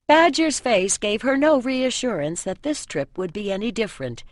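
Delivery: ordinary delivery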